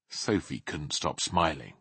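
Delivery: plain reading voice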